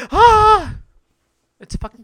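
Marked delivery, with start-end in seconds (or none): 0.1-0.8 high loud shriek; shrill cartoon shriek; mock scream. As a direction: let out a sudden high scream. Mock scream after quote.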